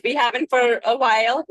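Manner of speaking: usual speaking voice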